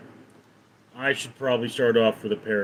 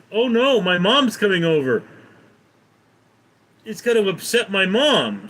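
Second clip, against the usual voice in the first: high, loud panicky guy; panicked; 5 semitones higher, 3 dB louder than usual. Acted. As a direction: fret out loud as the worried son. Panicked guy in parrot joke.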